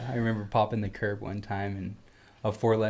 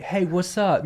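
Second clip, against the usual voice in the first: higher, drawn-up casual hello; casual greeting; 8 semitones higher, slower than usual. Shown as a demonstration. Dad teaching kid another greeting, casual greeting. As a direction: greet like a cool buddy. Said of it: "Or you'd be like".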